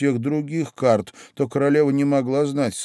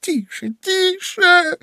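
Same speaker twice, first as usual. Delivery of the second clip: high, urgent hush rising higher; pleading whisper; 4 dB louder, much slower than usual. A narrator's reading. Rabbit begs laughing Alice to hush, pleading. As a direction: beg for silence, climbing in pitch. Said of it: "умоляюще зашептал кролик".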